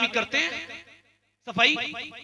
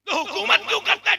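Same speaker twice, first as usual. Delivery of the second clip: scolding shout; 9 semitones higher than usual. Acted. Old woman rebukes Umar.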